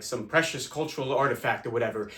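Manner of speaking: ordinary delivery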